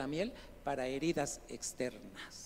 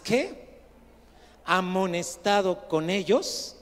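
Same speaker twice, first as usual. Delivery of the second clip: loud, deliberate proclamation; projected pulpit reading with drawn-out key words; emphatic scripture reading; 3 semitones higher, 7 dB louder than usual. Real, not acted. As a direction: read it out slowly to the congregation. Reads scripture aloud at force.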